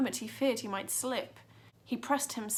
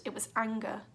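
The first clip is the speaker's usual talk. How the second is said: ordinary delivery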